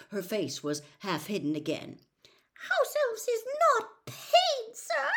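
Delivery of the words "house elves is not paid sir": very high, slow horrified squeak; Winky's muffled squeak. A narrator's reading. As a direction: squeak it slowly, scandalised, as a tiny elf. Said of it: "she said in a muffled squeak".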